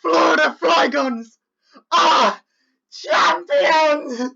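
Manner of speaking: high, loud, slow crying announcement; triumphant tearful shout. Real, not acted. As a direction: proclaim victory through tears. Announcing championship win.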